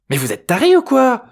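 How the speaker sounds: high, loud outraged bellow. A narrator's reading. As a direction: yell at them, outraged. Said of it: "beugla l'aliénoïde". (beuglement aigu et fort)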